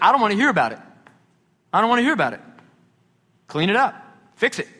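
acted-out impatient command; stern rebuke. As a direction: lay down the law, one order at a time. Acting out Paul rebuking grumblers.

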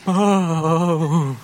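mock excited dog greeting; drawn-out, half-played doggy delight; overexcited dog. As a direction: play the dog gasping at an arrival. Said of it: "gets overly excited".

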